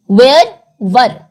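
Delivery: slow careful "वेयर" then short "वर"; contrasting where and were. Shown as a demonstration. Teacher modelling "वेयर" and "वर" for students, slow and careful. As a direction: say "वेयर" slowly with a long open vowel, then clip "वर". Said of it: "different है".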